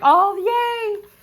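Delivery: high, loud happy squeal; loud, high-pitched yay; delighted cheer. Retold or imitated. Reacts to good news.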